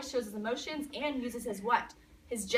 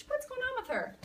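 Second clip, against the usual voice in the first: baffled dad; 10 semitones higher, 4 dB quieter than usual. Retold or imitated. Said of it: "He's like".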